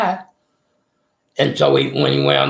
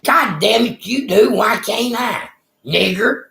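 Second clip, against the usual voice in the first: pitched-up mock shout at the guards; retold angry yelling; 9 semitones higher than usual. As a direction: replay the row, voice pushed up high and hot. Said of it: "And I said".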